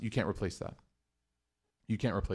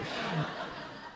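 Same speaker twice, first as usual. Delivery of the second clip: laugh; 4 semitones higher, 11 dB quieter than usual. Real, not acted. Laughing at the story.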